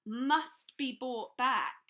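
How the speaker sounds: a thin voice parroting his partner; high voice. A narrator's reading. Mister Lowe echoes Trapwood in a high voice. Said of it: "in a high voice".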